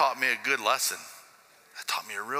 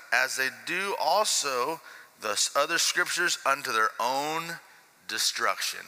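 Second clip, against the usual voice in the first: slow, measured Bible reading; scripture reading; 5 semitones higher than usual. Acted. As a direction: read the verse with reverent care. Reads scripture aloud.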